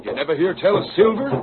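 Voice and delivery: fast and high, impatient; quick incredulous question; excited. Acted. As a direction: rush it out, amazed they don't know. Outlaw presses the others.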